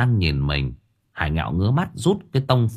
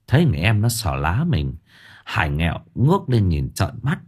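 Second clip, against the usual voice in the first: usual speaking voice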